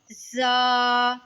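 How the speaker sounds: जा with a clear whistle; hissing zaa. Shown as a demonstration. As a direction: let जा hiss. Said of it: "इसमें सीटी आएगी". (जा सीटी के साथ)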